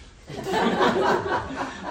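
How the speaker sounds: loud, high breathless laugh; high wheezing laugh. Real, not acted. Preacher cracking up at his own snake story, wheezing laugh.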